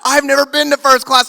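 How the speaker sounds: ordinary talk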